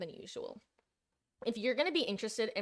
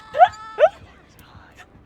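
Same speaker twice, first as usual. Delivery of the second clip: high loud cackling laugh; real loud laughter; loud laugh burst; 17 dB louder than usual. Real, not acted. Big laugh mid-game.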